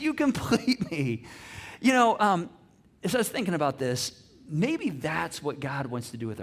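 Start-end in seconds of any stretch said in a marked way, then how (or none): 0.0-1.2 "You complete me" drawn out slowly and lovingly; hammed-up tender movie quote; tender movie line.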